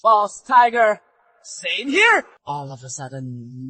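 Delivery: normal talking voice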